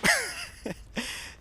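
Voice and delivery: real high, quick chuckle; amused chuckle. Real, not acted. Amused that a Brit objects to American English, chuckling. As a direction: let out a short run of chuckles.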